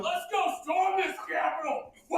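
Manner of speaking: hammed-up rioter whooping on TV; rowdy rioter shout. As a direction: yell like a rioter on TV. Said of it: "he's like".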